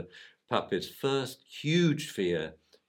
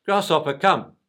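a louder, firmer call to the dog; 6 semitones higher, 9 dB louder than usual. Retold or imitated. Owner calling his puppy in the park, louder. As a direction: call the dog back, firmly and loudly. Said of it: "he gets a bit louder".

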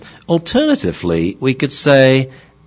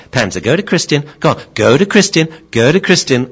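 insistent, higher, louder repeated command; nagging mum; 6 semitones higher, 5 dB louder than usual. Retold or imitated. Mum still talking over her child's turn, insistent. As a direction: keep pushing the dog to go. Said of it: "usually Mum will say".

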